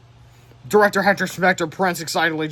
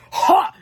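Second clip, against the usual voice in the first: sharp, much higher triumphant laugh; gloating ha; 14 semitones higher, 8 dB louder than usual. Acted. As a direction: bark a single mean laugh. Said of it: "Mister Crump laughed".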